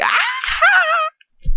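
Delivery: very high, loud cackling shriek; cackling scream. Shown as a demonstration. Reader shows Bellatrix's laughter, a cackling scream. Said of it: "That's my cackling scream".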